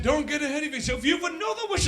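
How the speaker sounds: lightly voiced high goblin. Goblin warning the comic, lightly high. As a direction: chirp it like a small knowing goblin.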